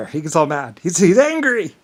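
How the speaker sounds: high, loud mock outrage; mocking taunt. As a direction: tease it loudly, climbing on "angry". Mimics others mocking his anger.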